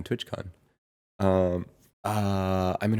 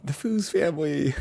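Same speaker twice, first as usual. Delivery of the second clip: excited imitation; 8 semitones higher than usual. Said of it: "so excited".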